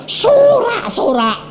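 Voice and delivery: high, drawn-out joyful cry; a hint of a cheering crowd; mock cheering. Survivors reach the beach, high drawn-out cheer.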